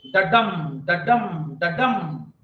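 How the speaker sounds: da-DUM, light then heavy, like a heartbeat; iambic heartbeat. Shown as a demonstration. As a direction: tap out the da-DUM rhythm. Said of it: "An unaccented beat, followed by an accented beat".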